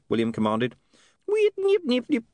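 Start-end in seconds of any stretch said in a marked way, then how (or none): 1.3-2.2 very high squeaky nipping; recording stuttering; high imp glitch chatter; imp skipping noise. A narrator's reading.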